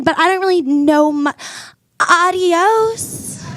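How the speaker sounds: normal talking voice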